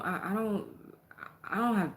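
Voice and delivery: ordinary delivery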